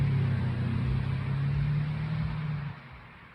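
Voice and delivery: a drawn-out rueful laugh; long laugh. Real, not acted. Caller laughing at his own story. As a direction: laugh it off at length.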